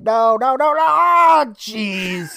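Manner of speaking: loud high cartoon wail of dismay; mock panicked scream. Co-host imitating old man losing the girl, mock panicked scream. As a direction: yelp escalating noes into a drawn-out scream. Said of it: "the old man starts screaming".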